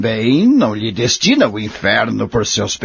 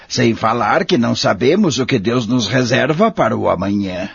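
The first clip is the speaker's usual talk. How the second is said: normal talking voice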